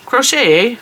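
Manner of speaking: high, bright mock Canadian accent. Shown as a demonstration. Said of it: "How's my Canadian accent?".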